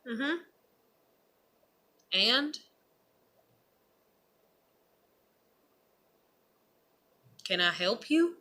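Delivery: unmoved replies with long gaps; deadpan and unhelpful; blank, unimpressed. Acted. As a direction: give them nothing, just a questioning stare. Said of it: "with a blank stare and I'm like".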